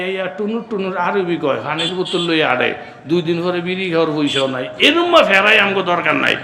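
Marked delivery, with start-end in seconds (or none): 4.8-6.4 loud, raised preacher's shout; rousing crowd call. Real, not acted. Preacher asking the congregation to speak up, rousing shout. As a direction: demand a loud answer from the crowd.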